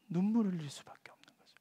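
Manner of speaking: soft unvoiced whisper; tearful whisper. Preacher whispering the psalmist's helpless weeping. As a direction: breathe it out in grief.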